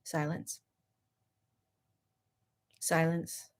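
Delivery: soft, drawn-out silence; quiet, restrained; hushed. Shown as a demonstration. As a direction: let the word drop to near nothing. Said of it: "force myself to".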